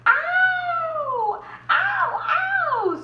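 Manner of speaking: very high, loud cartoon yelps of pain; screaming wolf. Wolf screaming after landing in boiling water. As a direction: yelp like a burned wolf. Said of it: "screamed the wolf".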